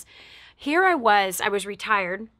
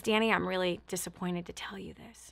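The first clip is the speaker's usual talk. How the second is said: hushed bad news; 3 semitones lower, 9 dB quieter than usual. Lady delivers bad news softly.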